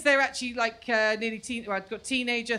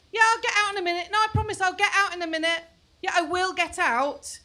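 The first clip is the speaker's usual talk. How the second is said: higher voice, breezy promises; stalling teenager; 6 semitones higher, 4 dB louder than usual. Retold or imitated. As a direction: fob mum off from the shower. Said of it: "She's like".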